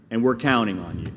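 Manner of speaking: normal talking voice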